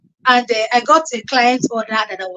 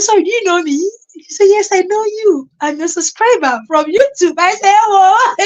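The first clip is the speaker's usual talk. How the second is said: excited retelling; 8 semitones higher, 6 dB louder than usual. Retold or imitated. Excited dialogue retold.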